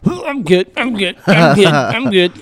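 imitating the sick hunter insisting he is fine; mock sick guy. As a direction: mimic the queasy guy brushing it off.

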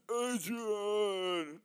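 high, loud mock cry of agony; hammed-up Rocky yelling for Adrian; agonized yell. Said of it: "When he's yelling in agony".

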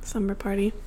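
whispering. Acted. Echoing slumber party excitedly.

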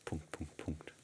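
soft, trailing. Real, not acted. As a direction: let it fade out. Spoken dot dot dot.

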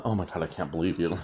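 low, quick mock exasperation; mildly mocking, dismissive disbelief; eye-rolling disbelief. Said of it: "people are rolling their eyes like".

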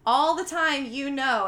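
loud, emphatic retold outburst; retold yell. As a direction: imitate her yelling, not at full volume. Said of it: "yells it, and she's like".

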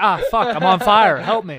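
loud mock scream, laughing through; burning scream. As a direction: yell like you're on fire. Acting a burn victim.

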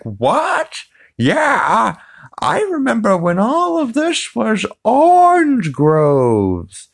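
put-on very high, slow, warbly old lady voice. Super old lady in a diner reminiscing to a teen, high and slow. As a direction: creak it out like a rambling granny. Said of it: "this super old lady".

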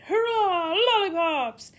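high, very loud cheer; chorus shout. Acted. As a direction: yell it out with a crowd of crocodiles. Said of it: "A whole chorus of voices shouted".

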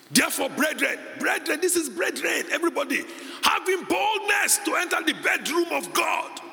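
high, forceful scripture proclamation; preaching shout. Real, not acted. Preacher urging brethren to boldness before God, shouted proclamation. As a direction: cry it out to the whole congregation.

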